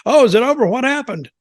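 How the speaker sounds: lightly voiced sleepy fan, surprised the show ended; mock groggy viewer. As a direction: play a dozing fan jolting awake. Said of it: "Then they woke up when it's over".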